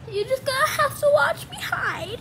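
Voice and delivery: high, whiny little voice; mock whimper. Kid hides from Dad's Gollum, high mock whimper. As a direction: whimper it high, playing scared. Pretend fright, going to hide.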